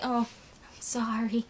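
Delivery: soft unvoiced whisper, barely there; hushed sheepish sorry; whispered apology. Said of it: "I'm like".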